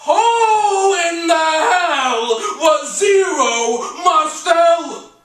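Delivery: loud, high, stretched-out wail; theatrical cry of bafflement; bewildered outburst. Acted. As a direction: bellow it slowly in disbelief. Stunned inner outburst at new name.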